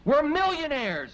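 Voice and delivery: gleeful high exclamation; overjoyed. Acted. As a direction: crow it with delight. Overjoyed shout.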